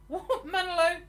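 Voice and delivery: high, laughing incredulity; baffled disbelief. Real, not acted. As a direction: burst out in amused confusion. Baffled by viewer's comment.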